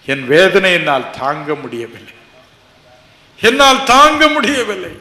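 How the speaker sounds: rising to a loud high cry; desperate plea. Retold or imitated. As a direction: beg that you cannot bear it. Pleading his pain to Jesus.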